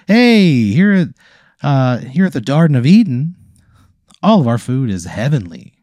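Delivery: bright-eyed pitch, starting high and loud; TV promo host. Acted. Table TV pops up to plug the food, cheery announcer. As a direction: sell it like a cheesy advert, easing down. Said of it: "a little T V pops up on your table".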